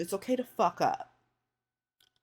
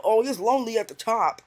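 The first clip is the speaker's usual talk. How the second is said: mock braggart; 4 semitones higher, 4 dB louder than usual. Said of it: "you know".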